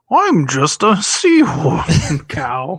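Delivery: manatee voice. Retold or imitated. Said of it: "Jim Gaffigan's manatee joke thing".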